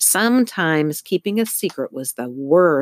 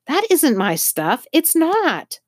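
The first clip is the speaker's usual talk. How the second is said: desperate denial; 11 semitones higher than usual. A narrator's reading. Arrested neighbour protesting to the police, high and frightened.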